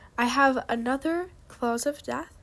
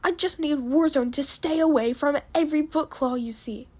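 higher and louder, firm commanding dragon; stern father; 4 semitones higher, 5 dB louder than usual. Acted. Father Shotgun ordering Cutlass and Warzone, loud and stern. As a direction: lay down the law to them both.

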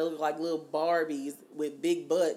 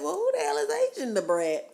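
much higher, puzzled retelling; baffled question; 13 semitones higher than usual. Retold or imitated. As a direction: ask it high, in mock bafflement. Retells own baffled reaction.

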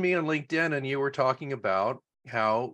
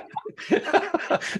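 airy high-pitched laugh; high breathy laugh. Real, not acted. Host cracking up at the guest, breathy laugh.

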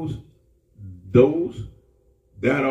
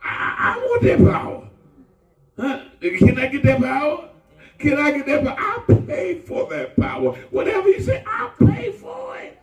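pitched-up pleading voice acting out Simon, shouting at the end; eager Simon begging; 10 semitones higher than usual. Preacher voicing Simon begging apostles for power, high pleading shout. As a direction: beg for that power, voice climbing high.

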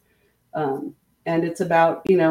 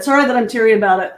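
said up louder through tears with a laugh; louder repeat; 5 semitones higher, 7 dB louder, much faster than usual. Real, not acted. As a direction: repeat it up and clear for the listener. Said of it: "What's that?".

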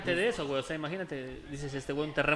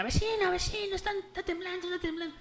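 high mock alarm of a neighbour woman; 12 semitones higher, 4 dB quieter than usual. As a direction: call out the warning, higher. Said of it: "Y llega una vecina". (vecina alarmada, voz aguda)

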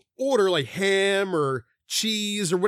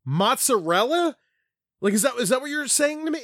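incredulous; 7 semitones higher than usual. Retold or imitated. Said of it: "And I'm like".

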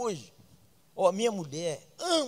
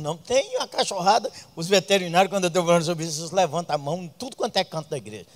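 ordinary talk

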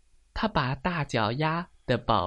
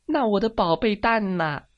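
high, louder anxious question; concerned duck; 9 semitones higher, 5 dB louder than usual. Acted. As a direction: ask urgently as the duck parent. Duck asks after its egg.

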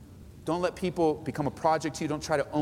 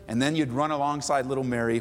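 normal talking voice

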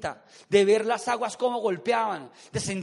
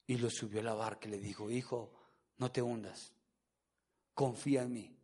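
tender version; 10 semitones lower, 6 dB quieter than usual. Acted. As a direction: soothe like a gentle father lifting his child. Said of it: "Ese texto dice todo lo contrario". (lectura tierna, grave y muy suave)

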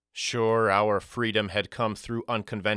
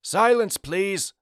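sharp, much higher and louder call for silence; stern demand; 11 semitones higher, 4 dB louder, slower than usual. A narrator's reading. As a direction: command the room to hush, old man voice. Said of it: "he demanded".

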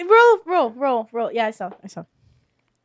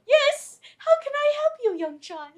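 high sweet guard voice; 12 semitones higher, 3 dB quieter than usual. Acted.

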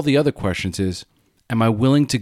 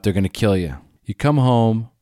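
ordinary delivery

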